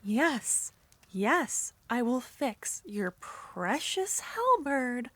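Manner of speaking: ordinary talk, nothing marked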